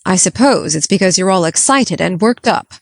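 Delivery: disapproving. Acted. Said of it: "said Marilla disapprovingly".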